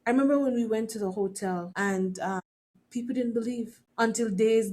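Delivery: ordinary talk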